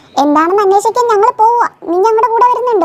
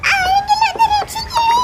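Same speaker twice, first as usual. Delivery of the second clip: high, drawn-out wail; desperate plea for rescue; 12 semitones higher, 4 dB quieter than usual. Acted. Drowning child begging anyone to save them, desperate.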